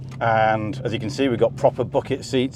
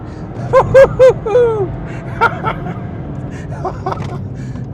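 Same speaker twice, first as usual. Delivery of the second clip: long high-pitched delighted laugh; high giddy laugh; 5 dB louder than usual. Real, not acted. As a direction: laugh out loud with real joy. Thrilled on track.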